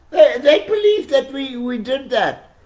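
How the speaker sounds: high, loud, laughing disbelief; excited laughing. Real, not acted. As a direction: crow it out, still laughing. Laughing at how gullible they were.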